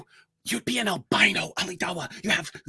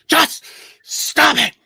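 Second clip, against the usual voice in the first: loud exasperated yell; 7 dB louder than usual. Real, not acted. To the grape-eating rival, a loud fed-up yell. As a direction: raise the voice in mock outrage. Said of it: "you're making me yell".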